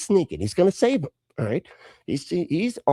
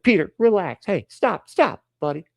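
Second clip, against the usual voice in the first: mock urgent restraint; 8 semitones higher than usual. Jesus retold restraining Peter.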